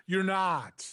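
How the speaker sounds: ordinary delivery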